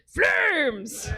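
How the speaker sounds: hearty fan shout back; second fan's answering shout. Retold or imitated. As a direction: shout the team name back proudly. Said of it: "the second guy goes".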